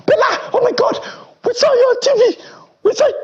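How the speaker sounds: squealed, very high-pitched fan mimicry; imitating gushing schoolmates. Old schoolmates spotting him on TV, gushing in falsetto.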